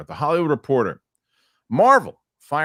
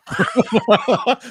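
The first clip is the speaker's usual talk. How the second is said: burst of laughter; 13 semitones higher, 7 dB louder than usual. Real, not acted. Laughing at his own story.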